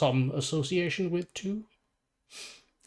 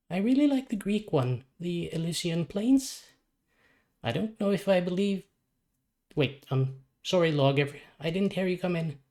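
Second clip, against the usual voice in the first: put-on young boy Robin; 6 semitones higher than usual. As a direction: voice the sick boy, dreamy and a bit lost. Child Robin voiced in story.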